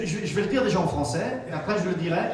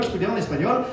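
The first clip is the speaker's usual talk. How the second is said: ordinary delivery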